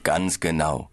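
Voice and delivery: ordinary talk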